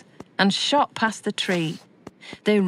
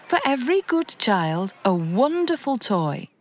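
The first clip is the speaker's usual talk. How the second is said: usual speaking voice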